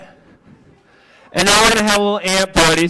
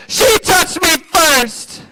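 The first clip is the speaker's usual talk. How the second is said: put-on high, slow whining child cry; whiny kid; 8 semitones higher, much slower than usual. Shown as a demonstration. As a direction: wail it like a tattling kid. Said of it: "you point back and you say".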